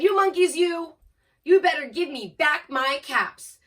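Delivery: loud, high scolding threat; exasperated storybook shout; quite angry peddler. A narrator's reading. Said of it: "Now he felt quite angry".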